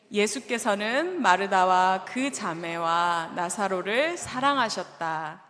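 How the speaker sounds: slow, measured scripture read. Shown as a demonstration. Pastor leading the congregation in reading, slow and solemn. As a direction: read the verse slowly and solemnly. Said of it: "한번 다 같이 읽어볼까요?".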